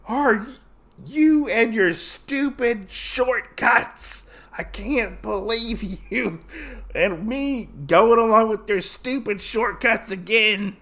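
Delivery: high, exasperated comic rant; mock angry scolding. Usagi scolding Gin after the fight, hammed-up exasperation. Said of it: "with a really, really mean look".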